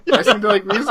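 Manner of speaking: high, loud, collapsing into laughter; breaking up. Real, not acted. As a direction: lose it mid-sentence. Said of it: "Having a hard time".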